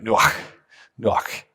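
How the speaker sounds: nuach ending in a harsh throat ch; guttural nuach. Shown as a demonstration. Pastor demonstrating nuach to the church, guttural. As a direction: spit the ch of nuach from the back of the throat. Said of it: "spitting out of the back of your throat".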